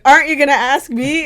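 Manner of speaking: mock outrage, pushing up and loud; retold indignant question. Podcast host demanding her turn, mock indignant. Retelling podcast hosts' exchange.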